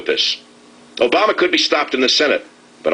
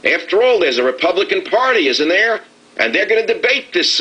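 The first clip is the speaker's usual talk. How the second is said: sing-song, higher, sarcastic wide-eyed questions; mock naive hope; 8 semitones higher than usual. Host mocking faith in the Republican Party, sarcastic higher sing-song. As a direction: feign innocent belief with a high lilting voice.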